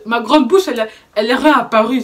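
ordinary delivery

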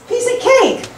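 high, airy coach voice; piece of cake pitched high. Retold or imitated. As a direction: toss it off lightly up high. Tennis pro quoted, voice jumps.

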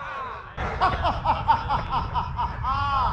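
high, drawn-out cackle; long staccato laugh. Real, not acted. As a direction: laugh in a long string of ha-has. Villain-like laugh after a play.

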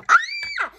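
very high excited squeal; squealing over a tiny toy; delighted shriek. Real, not acted. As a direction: shriek with joy.

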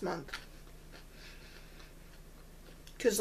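muffled behind palm; speaking through fingers; hand over mouth. Real, not acted. Seen 3: Hand covers mouth 0.4–3.6s; chewing not clearly visible.

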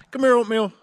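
bright call to a puppy; calling the dog. Real, not acted. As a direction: call her over from across the spot.

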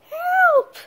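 a sudden high pretend scream for help; cry for help. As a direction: call out like a kidnapped princess. Captured Peach cries out.

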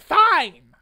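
sharp loud shout; shouting back at the store manager. Retold or imitated. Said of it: "So I shouted".

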